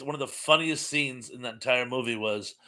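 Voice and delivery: ordinary talk, nothing marked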